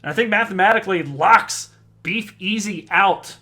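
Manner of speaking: triumphant announcer's cry, stretching the name; game shot call. Real, not acted. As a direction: belt out the leg win like a darts caller. Calls the winning dart.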